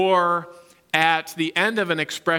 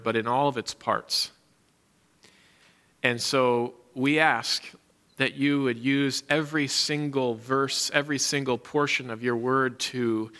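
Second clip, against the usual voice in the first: prayer; 5 semitones lower, 3 dB quieter than usual. Real, not acted. Leads a prayer.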